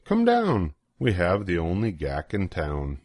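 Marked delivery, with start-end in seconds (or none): none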